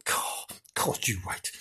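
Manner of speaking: hushed, low-volume grumble; whispered threat. Acted. Janice threatening the kids who threw a ball, whispering. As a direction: mutter threats under your breath.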